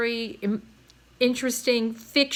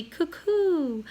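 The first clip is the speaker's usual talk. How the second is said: high cuckoo call; 7 semitones higher than usual. A narrator's reading. Lewis unable to say cuckoo.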